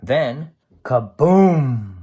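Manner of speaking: slow, loud storybook kaboom; big read-aloud explosion; dramatic boom. A narrator's reading. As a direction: stretch out the blast for the kids.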